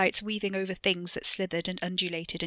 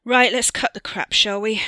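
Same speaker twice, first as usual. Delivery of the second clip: loud, higher, no-nonsense challenge; brusque confrontation; 3 semitones higher, 11 dB louder than usual. A narrator's reading. Wesley drops the flirting to confront Theo, loud and brusque. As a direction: cut in loudly, all business. Host abruptly confronts his guest.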